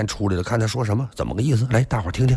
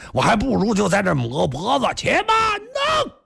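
desperate shout; 10 semitones higher than usual. Acted. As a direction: cry out in despair before the sword. King threatens suicide. (国王绝望地高声喊叫)

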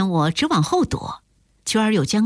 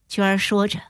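ordinary delivery, nothing marked

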